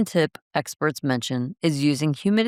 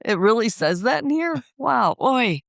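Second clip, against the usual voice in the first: higher and giddy, teasing; teasing her partner as overexcited; mock excitement; 7 semitones higher, slower than usual. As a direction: play an eager fan spotting a chance. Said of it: "and you're like".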